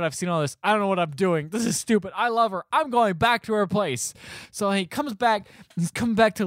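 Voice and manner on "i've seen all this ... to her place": higher, mounting lovestruck resolve; worked-up declaration. Retold or imitated. Movie hero resolving to go back to her, worked-up. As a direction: play a mock-dramatic change of heart. Acting out man's change of heart.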